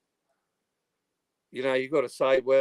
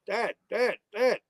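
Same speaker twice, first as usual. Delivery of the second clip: higher repeated calling of Dad; 10 semitones higher, slower than usual. Retold or imitated. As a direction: try to reach a delirious father. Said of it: "I said".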